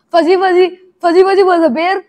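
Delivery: high, loud rushed tongue twister; tongue twister speeding up. Shown as a demonstration. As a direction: race through the rhyme fast. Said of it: "really fast".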